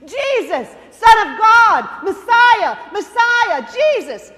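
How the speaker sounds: a blind beggar hollering for Jesus, full voice. Acted. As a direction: shout each name over a crowd, straining to be heard. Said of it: "He hollered at Jesus".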